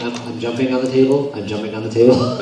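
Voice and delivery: low, soft, offhand; lightly voiced casual jumper; mock casual. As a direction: shrug it off like it's nothing. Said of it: "Like, just like".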